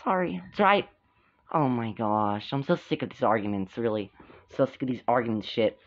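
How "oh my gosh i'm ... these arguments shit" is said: low, soft, weary character voice; fed-up figure. Pretend. Figure sick of the arguments, low soft fed-up voice. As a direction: grumble it quietly, pitched way down.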